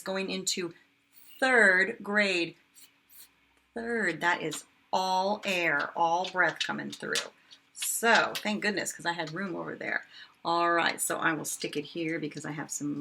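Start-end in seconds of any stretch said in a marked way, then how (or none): none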